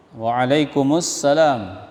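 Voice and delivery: "Waalaikumsalam" slowed with the m carried into "kumus"; slow voiced mu. Shown as a demonstration. Teacher modelling the correct reply to a greeting. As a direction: let the m of "Waalaikumsalam" sound open. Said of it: "Kumus, hidup dia".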